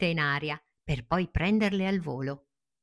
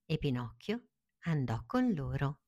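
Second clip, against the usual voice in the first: plain reading voice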